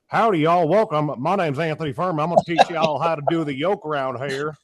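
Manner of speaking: a big Southern howdy to the crowd; Southern accent. Pretend. Coach greeting athletes in a Southern accent. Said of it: "Proper Southern, uh, accent going through there".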